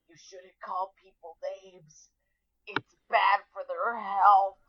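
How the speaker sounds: faint, drawn-out dying gasp of words; dying last breath. Retold or imitated. Melted Arthur warns his sister from the mug, dying faintly. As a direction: eke it out slowly, very softly, as if expiring. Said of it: "sounds like he's having his last breath".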